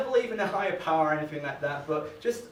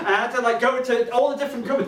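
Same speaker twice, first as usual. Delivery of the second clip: usual speaking voice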